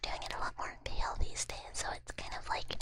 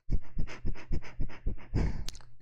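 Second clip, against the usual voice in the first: whispered laugh; 8 dB louder than usual. Real, not acted. Loud laugh at chat.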